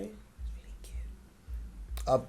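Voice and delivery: breathy unvoiced whisper. Real, not acted. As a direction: whisper it as an aside. Teasing aside.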